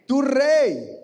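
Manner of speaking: loud, high cry of Tu rey; preacher's loud proclamation; emphatic exclamation. Acted. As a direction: proclaim the king with a burst. Preacher exclaims reading scripture.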